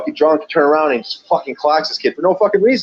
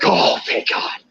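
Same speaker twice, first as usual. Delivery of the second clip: breathy whispered relief; relieved whisper; 4 dB quieter, slower than usual. Retold or imitated. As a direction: whisper it like a weight lifted. Relieved customer voiced.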